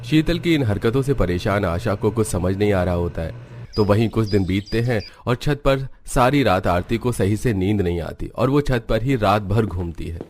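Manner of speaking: plain reading voice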